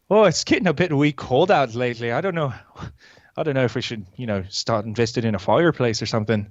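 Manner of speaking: attempted Celtic accent, Irish-Scottish. Shown as a demonstration. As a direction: chat in a put-on Irish-Scottish lilt. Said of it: "Irish accent or Scottish accent".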